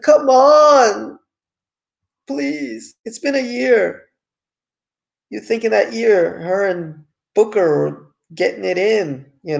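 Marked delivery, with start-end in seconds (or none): 0.0-4.0 higher, louder exasperated come on; exasperated plea. Retold or imitated. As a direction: plead in exaggerated frustration. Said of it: "And it's like".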